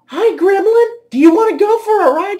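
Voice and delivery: very high mock-girly voice; high-pitched mocking impression of her; imitating a woman. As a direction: tease in a squeaky falsetto. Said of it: "she grabs the mic and says".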